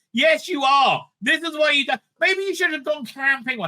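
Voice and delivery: high, heated mocking rant; exasperated rant. Real, not acted. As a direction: let the disgust boil over. Ranting at crying contestants.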